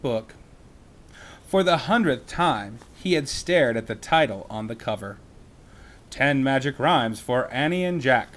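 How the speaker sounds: put-on lively read-aloud to children; story narration. A narrator's reading. Reading a children's book aloud.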